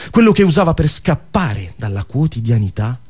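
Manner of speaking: usual speaking voice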